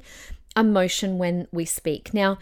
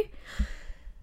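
big breathy exhale; long sigh; 12 dB quieter than usual. Real, not acted. Speaker finishing a long talk, sighing out. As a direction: let all the air out after talking.

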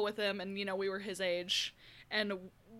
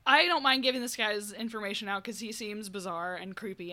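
ordinary talk, nothing marked

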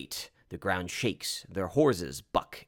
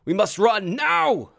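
high, loud, drawn-out shout; urgent command; 13 semitones higher, 9 dB louder, slower than usual. Acted. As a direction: shout the order to flee, slowly and hard. Urgent order to flee dragon.

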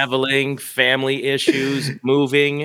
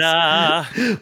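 ta-da announcement; 8 semitones higher than usual. Real, not acted. Announcing the 300th episode.

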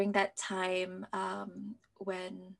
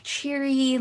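cheery emphasis; 5 semitones higher, 7 dB louder than usual. Retold or imitated. Describing her usual cheery image.

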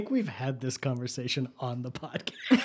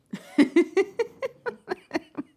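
high burst of laughter; 4 dB louder than usual. Real, not acted. Laughs at own joke.

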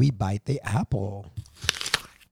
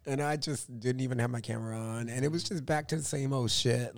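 ordinary talk, nothing marked

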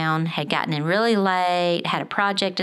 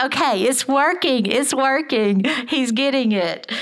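much higher, louder triumphant inner voice; thrilled the mantra finally stuck; excited realization; 7 semitones higher, 3 dB louder than usual. Retold or imitated. Mother cheering inside as her son repeats her words, excited and loud. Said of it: "I was like".

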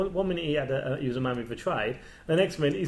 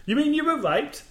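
mock shock; 12 semitones higher than usual. Retelling Brian's shocked question.

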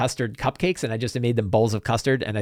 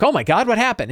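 higher-pitched shocked question; alarmed; 8 semitones higher than usual. Retold or imitated. Players see Sniffles with a bloody leg, alarmed. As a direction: imitate friends gasping at the injury.

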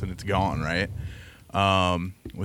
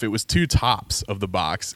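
ordinary talk

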